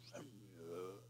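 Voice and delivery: very low, hushed, otherworldly call; God's voice. Retold or imitated. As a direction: drop right down into a deep, faint rumble. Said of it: "He would have known it wasn't Eli".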